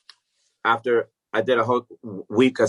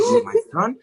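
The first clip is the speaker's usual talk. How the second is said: very high, squeaky mock astonishment; stunned disbelief; 3 dB louder than usual. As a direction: imitate a father floored by news of his child. Father stunned by the news.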